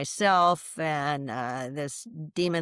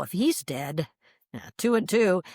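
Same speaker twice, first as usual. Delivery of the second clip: ordinary talk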